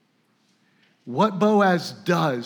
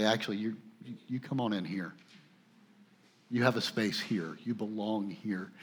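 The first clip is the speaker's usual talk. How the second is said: much softer, gentle inviting tone; welcoming; 5 semitones lower, 10 dB quieter than usual. Retold or imitated. Someone welcoming a lonely outsider inside, soft gentle invitation. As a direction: quietly draw the stranger in.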